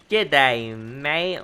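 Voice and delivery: hammed-up Australian greeting after a laugh; Australian accent. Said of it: "I do love a good Australian accent".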